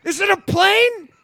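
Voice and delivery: hype announcer intro. Acted. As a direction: hype him like a superhero. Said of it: "Do Matt".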